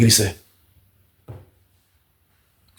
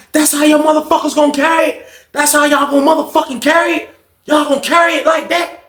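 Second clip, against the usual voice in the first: high-pitched angry mock holler; retold outburst, high and indignant; acted snapping; 13 semitones higher than usual. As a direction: snap at the whole group, pitched way up.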